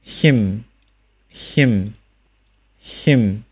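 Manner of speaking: "him" with an ee vowel into humming m; isolated careful "him", falling tone; final m demonstration. Shown as a demonstration. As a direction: seal the lips at the end of each "him". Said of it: "加收音".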